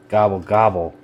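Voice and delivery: gobbling like a turkey; turkey impression. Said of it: "that's my turkey impression".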